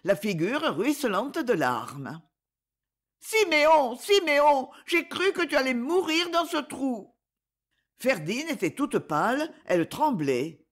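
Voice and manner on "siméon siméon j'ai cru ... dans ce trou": high, loud tearful cry of relief. Acted. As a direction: sob out his name after the fright. Ernest weeping after rescue.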